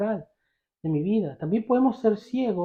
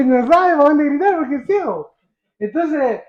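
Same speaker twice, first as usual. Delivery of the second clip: higher, louder, talking through a laugh; amused, laughing; 6 semitones higher, 4 dB louder than usual. Real, not acted. Preacher joking to congregation about the blind man, laughing. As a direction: laugh while answering your own question. Laughs at his own joke.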